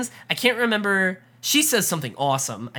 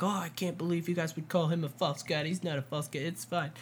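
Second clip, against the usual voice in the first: quoted aside; 11 dB quieter than usual. Retold or imitated. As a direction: toss the quote off quietly. Quoting Russell's indignant mock line.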